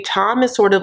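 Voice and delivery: normal talking voice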